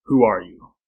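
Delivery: low, loud, cold challenge; cold demand. A narrator's reading. Tarzan confronting the intruders, cold and commanding. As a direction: demand it coldly, dropping the pitch. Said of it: "his voice came cold and level".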